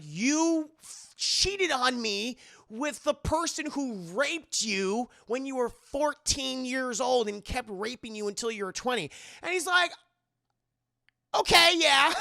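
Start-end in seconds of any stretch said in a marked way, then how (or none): 0.0-9.1 high, slow, indignant tirade; mock angry accusation. Wife accusing husband of cheating, high and indignant. 11.4-12.1 high, loud sheepish yeah; squeaky admission. Retold or imitated. Husband admitting it to wife, high squeaky voice.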